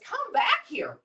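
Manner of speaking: high-pitched mock protest; high, indignant fish character; calling after someone. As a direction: cry out after a thief in a high storybook voice. Fish calls after fleeing Rabbit.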